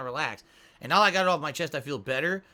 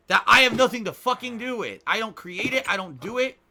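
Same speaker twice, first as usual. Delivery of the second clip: angry outburst; 6 semitones higher, 9 dB louder than usual. Real, not acted. Angry rant at trolls.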